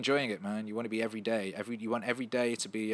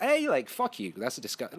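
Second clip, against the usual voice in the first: mock indignation; 3 semitones higher than usual. Acting out retort to deplatformers.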